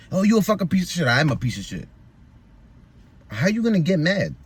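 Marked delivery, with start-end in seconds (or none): 0.1-1.1 mocking her insult. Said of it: "I just say it right back to her".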